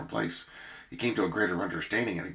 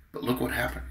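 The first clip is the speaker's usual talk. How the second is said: ordinary talk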